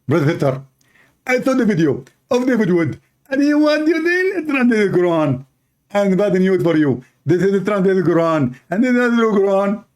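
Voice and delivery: mock Zakir Naik. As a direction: lampoon him as if tipsy and pompous. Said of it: "why are you talking like this? Are you drunk?".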